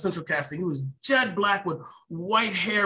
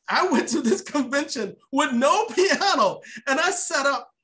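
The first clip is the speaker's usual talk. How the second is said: laughing; 7 semitones higher than usual. Real, not acted. Telling the host about the bold convention, laughing. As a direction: laugh at your own nerve. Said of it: "So I was bold".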